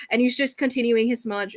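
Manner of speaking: ordinary talk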